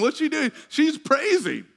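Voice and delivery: high-pitched excited exclamation; exhilarated. Real, not acted. Preacher describing the healed woman praising, exhilarated. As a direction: stay up high, riding the excitement. Preacher carried along by her celebration.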